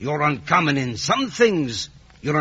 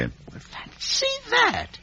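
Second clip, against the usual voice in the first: astonished; 4 dB quieter, much slower than usual. Acted. Joe astonished by news.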